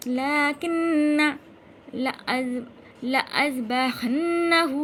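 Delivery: loud, very slow chant; recitation demo. Shown as a demonstration. Teacher showing how to read it, loud slow recitation. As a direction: hold the long syllables, strong teaching voice. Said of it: "क्या पढ़ेंगे?".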